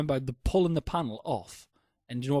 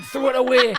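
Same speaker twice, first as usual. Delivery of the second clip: laughing mock order; 8 semitones higher, 7 dB louder than usual.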